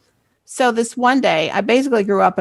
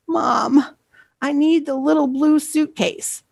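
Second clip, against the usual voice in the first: higher-pitched, imitated plea to mom; pleading child; 7 semitones higher than usual. Embarrassed girl to mom through door, pleading. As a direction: plead discreetly without raising the volume.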